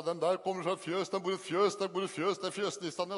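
put-on children's taunt. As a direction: jeer like schoolkids circling the new boy. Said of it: "så hoppet de rundt oss og sa". (hånende barneregle, ertende og høy)

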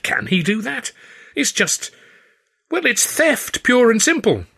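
high, rising indignation; outraged at the theft; indignant. A narrator's reading. Stan pleading to Claude, indignant.

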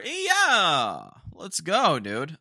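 loud, high hype yell; hyped cheer. Real, not acted. Vlogger cheering himself on, loud hype yell. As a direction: pump yourself up with a shout. Hyping himself up after ranting.

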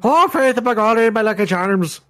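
put-on Irish accent. Riffing on stage Irish priest.

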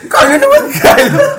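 high laughing shriek. Real, not acted. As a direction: squeal the name through laughter. Group cracks up at name.

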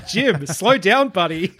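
high, drawn-out plea through laughter; laughing plea. Acted. Viewer pleads with raging sheriff, amused and stretched out. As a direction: laugh as you beg him to ease off. Viewer reacting to character's outburst.

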